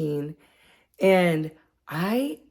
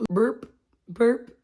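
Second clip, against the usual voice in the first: higher mock burp sounds; imitated burps; 6 semitones higher than usual. Shown as a demonstration. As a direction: play out little burps. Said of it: "you're just like".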